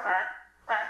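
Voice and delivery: short croaking car noise; imitated frog croak. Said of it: "makes a noise like a frog".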